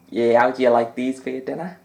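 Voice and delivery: lightly voiced Scottish commentator catchphrase; a hint of McManus's Scottish lilt; Alan McManus impression. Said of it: "Alan McManus is just".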